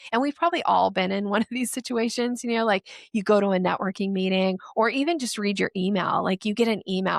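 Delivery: normal talking voice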